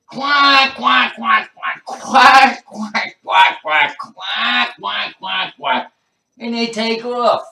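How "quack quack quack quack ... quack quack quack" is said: loud, high frantic duck quacks; duck quacking. Retold or imitated. Ducks after chewing the crack, loud wild quacking. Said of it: "you hear 'em say".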